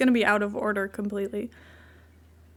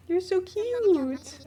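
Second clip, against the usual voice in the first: high, very slow coo; adoring pet-talk; 7 semitones higher, much slower than usual. Real, not acted. Owner adoring the pet, high drawn-out coo. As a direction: stretch it out, cooing high.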